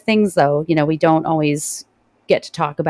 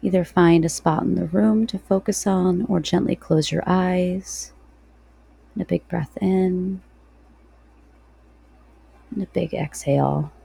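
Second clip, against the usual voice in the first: guided meditation. Real, not acted.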